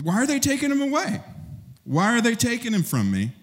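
high, pleading, desperate why; anguished cry. Retold or imitated. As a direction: cry out as if your master is being taken. Peter's anguish, voiced by preacher.

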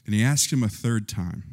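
usual speaking voice

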